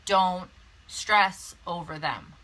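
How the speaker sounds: normal talking voice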